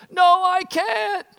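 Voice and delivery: put-on high, slow tearful child; scared refusal. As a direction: refuse through fear, almost crying. Scared boy refusing, voiced.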